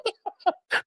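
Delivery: soft, high-pitched giggle; high laugh. Real, not acted. Amused by his own jam story, high soft laugh.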